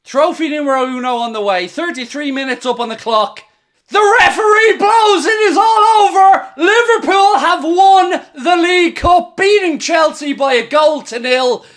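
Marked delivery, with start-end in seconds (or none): 3.9-11.6 tearful cheering. Real, not acted. Final whistle, team wins the cup.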